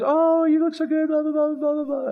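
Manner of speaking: high mock-gushing praise; gushing admirers. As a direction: squeal it like fawning fans. Gushing friends on selfie.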